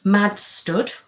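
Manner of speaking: ordinary delivery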